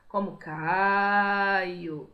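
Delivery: very slow, stretched ghostly moan; voz cavernosa. Shown as a demonstration. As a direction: draw out the name like a ghost calling from a cave. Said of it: "Aquela voz bem grossa chamando o comprador". (voz cavernosa de fantasma, bem lenta e arrastada)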